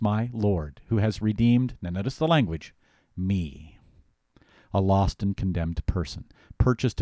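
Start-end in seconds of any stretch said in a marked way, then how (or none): 3.2-3.8 me, set apart with weight; stress on me. Shown as a demonstration. Pastor teaching the catechism, stressing me. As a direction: lean on me, as the point. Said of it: "Notice the emphasis I put on those words".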